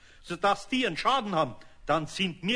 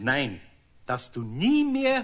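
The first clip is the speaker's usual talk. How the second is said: raised stern voice; firmer insistence; 4 semitones lower, 4 dB louder, slower than usual. Acted. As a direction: push nie harder. Eder insisting louder.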